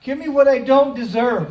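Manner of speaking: loud shouted plea; yelling out. Acted. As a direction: beg for undeserved mercy at full volume. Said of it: "he just starts to yell out".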